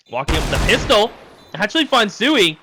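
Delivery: loud, high esports call; live play-by-play. Real, not acted. Caster calls the defuse attempt to viewers, excited play-by-play.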